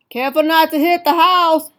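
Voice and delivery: dad hollering up at the sky; father yelling. Acted. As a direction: shout a warning to a kid flying overhead. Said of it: "Violet's father would yell".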